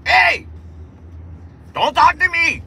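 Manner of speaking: put-on high, angry shout in Mexican Dracula accent. Driver silencing a passenger, shouted Mexican Dracula accent. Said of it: "I'm like a Mexican Dracula".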